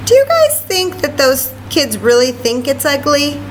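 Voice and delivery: ordinary delivery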